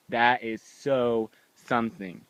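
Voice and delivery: normal talking voice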